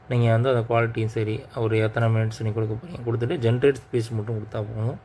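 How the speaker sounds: normal talking voice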